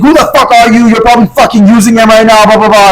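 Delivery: high-pitched mock phone tirade; imitated angry screaming. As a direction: mimic the screaming boyfriend, trailing into blah-blah. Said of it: "Like, screaming at me".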